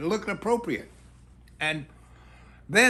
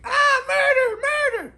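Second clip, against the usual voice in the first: much higher and louder, a hammed-up shriek of murder; the neighbour shrieking murder as he hits her dog; mock screaming; 7 dB louder than usual. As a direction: shriek it like a melodrama victim. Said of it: "the woman starts screaming".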